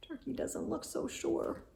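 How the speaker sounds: breathy whispered aside. A narrator's reading. Narrator whispers about the doubtful turkey, whisper. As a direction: whisper it to the listener.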